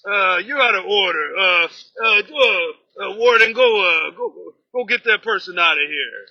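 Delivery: loud, bossy shouted dismissal; mock chairman shouting. Union president yelling at a member to sit down. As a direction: bark orders to throw someone out.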